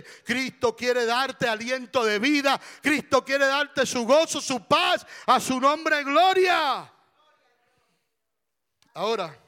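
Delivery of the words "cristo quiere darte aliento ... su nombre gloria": rousing pulpit cry, rising in force; full-force preaching. Real, not acted. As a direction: build louder and higher to a shouted acclamation.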